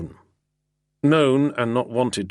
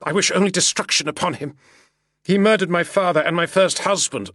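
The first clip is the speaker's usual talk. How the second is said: higher, louder, fast and furious; a burst of grief-fuelled anger; heated outburst; 11 semitones higher, 5 dB louder than usual. Acted. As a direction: spit out her hatred of the murderer. Accuses him of her family's murder.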